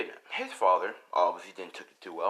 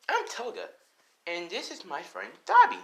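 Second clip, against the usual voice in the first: put-on high girlish introduction; Toga; 11 semitones higher, 4 dB louder than usual. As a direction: introduce yourself in a high, playful voice. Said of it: "And she's like".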